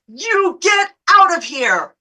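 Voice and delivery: high, loud, drawn-out mock yell; imitating a furious woman yelling; yelled order. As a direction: yell it slowly in her face.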